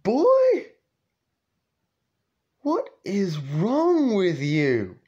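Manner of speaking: very high loud call, then slow; yelling dad. Retold or imitated. Dad shouting at the boy over the dead squirrels, high and slow. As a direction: holler at the kid in disbelief.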